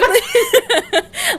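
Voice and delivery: squealing giggle; high laugh. Real, not acted. Host relating to Bella, squealing laugh.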